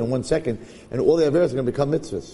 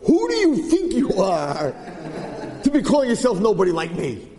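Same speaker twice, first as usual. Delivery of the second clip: indignant veteran; 5 semitones higher than usual. Retold or imitated. Veteran student scolding the new kid for calling himself nobody. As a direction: demand who he thinks he is. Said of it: "walks over to this new kid and says".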